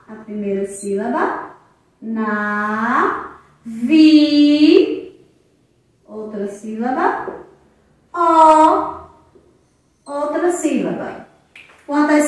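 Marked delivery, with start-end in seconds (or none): 2.0-5.3 "Na", "vi" as separate long syllables, "vi" louder; teacher chanting out "Na, vi" piece by piece. Shown as a demonstration. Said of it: "separar as sílabas". 8.1-9.0 "Ó" as a loud, held, separate syllable; last chunk "Ó", isolated and loud. Shown as a demonstration. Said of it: "separar as sílabas".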